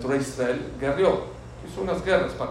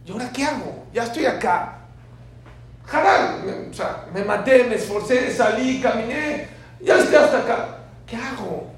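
much higher, louder anguished pleading; desperate pilgrim; 8 semitones higher, 7 dB louder than usual. Acted. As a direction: cry out lost and exhausted at the tomb. Pilgrim's desperate words at the tomb.

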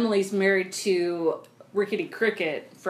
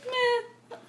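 high dismissive "meh, fine"; 13 semitones higher, much slower than usual. Retold or imitated. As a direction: brush it off, whiny and bored. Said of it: "Cooper's just like".

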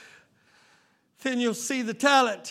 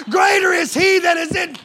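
loud, higher shouted verse; shouted scripture; 6 semitones higher, 6 dB louder than usual. Real, not acted. Preacher shouting the verse at full force. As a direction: belt the scripture. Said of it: "preaching peak".

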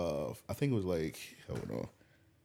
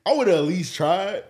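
louder, higher exasperated protest; emphatic mock protest; 6 semitones higher, 7 dB louder than usual. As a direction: argue it like the joke is obvious. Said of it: "but I'm like".

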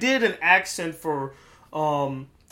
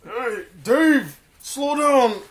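raised, louder stoner impression; higher-pitched comic plea; Seth Rogen; 5 semitones higher, 4 dB louder, much slower than usual. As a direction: call after a friend, goofy stoner voice. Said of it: "Can you do Seth Rogen?".

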